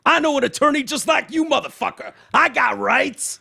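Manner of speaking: high, indignant mock outburst; indignant rant. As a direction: read it like an outraged passenger's rant. Reading out passenger's angry rant.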